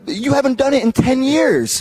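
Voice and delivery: high and slow, disbelieving; incredulous retort. Retold or imitated. Guest doubting Scotty's finisher, high and slow. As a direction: press him on it.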